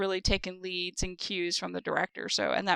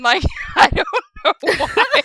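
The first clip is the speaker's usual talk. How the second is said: higher, laughing through the words; embarrassed laugh; 11 semitones higher, 6 dB louder than usual. Real, not acted. Confessing the accent slip to the host, higher and laughing.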